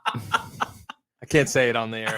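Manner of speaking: laughing it off, slow and amused. Real, not acted. Co-host dodging a doping question, laughing. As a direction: chuckle through the words. Joking about illegal substances.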